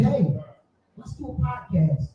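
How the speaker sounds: ordinary talk, nothing marked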